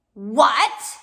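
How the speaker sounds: startled loud cry of disbelief. Acted. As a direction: burst out loud, caught off guard. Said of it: "Count Olaf cried".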